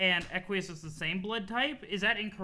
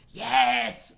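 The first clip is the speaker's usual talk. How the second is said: loud yes; 4 semitones higher, 9 dB louder than usual. Acted. Agreeing to a scheme, sudden loud yes. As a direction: burst out with the last yes.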